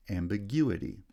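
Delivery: main stress on "gu" in "Ambiguity"; "Ambiguity", lighter first stress, strong third syllable, then falling; third-syllable primary stress. Shown as a demonstration. Teacher showing stress shift, third syllable strongest in "Ambiguity". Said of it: "primary stress is shifted to the third syllable".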